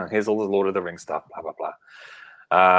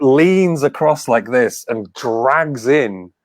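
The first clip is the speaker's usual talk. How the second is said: normal talking voice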